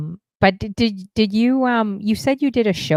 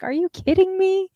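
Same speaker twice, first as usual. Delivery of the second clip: high-pitched mock disbelief; can't-believe-this reaction, voice shooting high; incredulous quote; 13 semitones higher than usual. Acted reaction to starting over.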